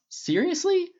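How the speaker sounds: very high incredulous shout; disbelieving yelp; exclaiming. A narrator's reading. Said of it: "Clint exclaimed".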